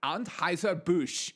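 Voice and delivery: put-on Anheuser-Busch slowed in a stray foreign-sounding accent; odd put-on accent. Host stumbling over the brand name, accidental put-on accent. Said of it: "I don't know where that accent came from".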